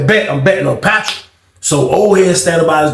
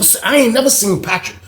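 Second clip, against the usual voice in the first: ordinary talk, nothing marked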